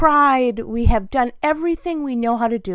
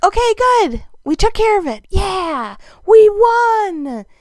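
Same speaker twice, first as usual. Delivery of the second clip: high, loud mock victory cheer; crowing over a small win, pitched up and loud; triumphant; 8 semitones higher, 6 dB louder than usual. Telling a friend she beat the mice, high and loud. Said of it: "And I'm like".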